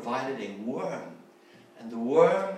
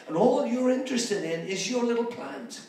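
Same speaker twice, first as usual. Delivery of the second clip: higher, louder rebuke over a little plant; God's rebuke; 7 semitones higher, 6 dB louder than usual. Acted. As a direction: scold him from above for caring only about his plant. God rebukes Jonah over plant.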